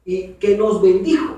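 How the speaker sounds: "y que nos bendijo" slowed right down, leaning on "bendijo"; slow emphasis; slow stress on bendijo. Shown as a demonstration. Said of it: "Cuando dice".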